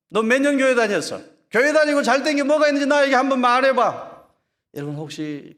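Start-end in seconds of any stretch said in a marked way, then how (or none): 0.1-4.3 high, loud jeering challenge; taunting mocker. Scoffer challenging a churchgoer, loud taunting. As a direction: scornfully demand an answer. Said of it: "그런 이야기 들어보셨습니까".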